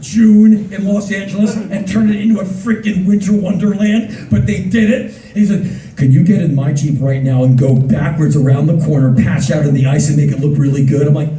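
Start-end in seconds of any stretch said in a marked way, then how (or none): 6.0-11.1 low, loud director voice; director's request. Retold or imitated. Director asking the actor to drive, low and loud. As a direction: boom it out like a big boss.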